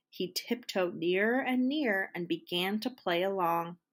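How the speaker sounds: ordinary delivery, nothing marked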